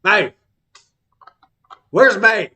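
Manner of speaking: loud, high holler for a student; teacher calling out. Acted. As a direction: bellow the roll call across the yard. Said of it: "he says Maeve".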